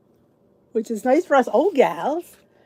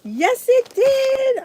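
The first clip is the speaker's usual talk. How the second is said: higher, slow thrilled yes; thrilled the package came; excited; 13 semitones higher, 5 dB louder, much slower than usual. Real, not acted. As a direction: savour the delight.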